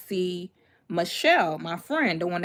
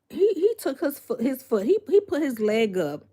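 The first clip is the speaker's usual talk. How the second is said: ordinary talk, nothing marked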